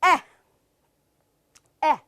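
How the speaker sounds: crisp separate "ਐ"; clipped "ਐ" burst; short E sound. Shown as a demonstration. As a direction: say "ਐ" short and open. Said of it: "The next is".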